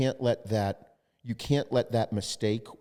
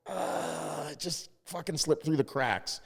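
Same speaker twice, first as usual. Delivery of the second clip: higher, softer, lame-excuse voice; sheepish excuse; 6 semitones higher, 3 dB quieter, slower than usual. Retold or imitated. Vendor excusing a mistake to the client, sheepish. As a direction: mumble it like a caught vendor.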